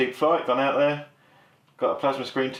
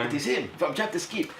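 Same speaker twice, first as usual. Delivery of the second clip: attempted Chilean accent; 3 semitones higher, 6 dB quieter, slower than usual. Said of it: "I don't know how people speak in Chile".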